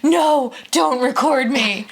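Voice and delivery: child's whine, voiced by a parent; protesting little girl. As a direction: imitate a kid objecting to the camera. Acting out daughter objecting to recording.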